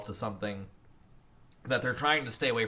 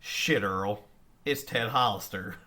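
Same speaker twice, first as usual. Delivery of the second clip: quoting movie line; 3 semitones higher, 7 dB louder, slower than usual. Retold or imitated. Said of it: "I always refer to this line".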